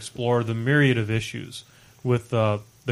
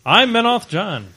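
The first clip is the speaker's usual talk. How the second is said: podcast intro announcer; 8 semitones higher, 6 dB louder than usual. Acted. Loud hammed podcast intro.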